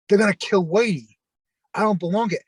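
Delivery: replaying his own protest, pitch pushed up; retold drunken acting up. Drunk friend protesting to his pals outside, mock panic. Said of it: "And I was acting up".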